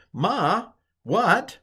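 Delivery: "Ma, what's up, Ma?" asked high and lifted; question word demo. Shown as a demonstration. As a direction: toss it off as a casual question. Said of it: "ma means what?".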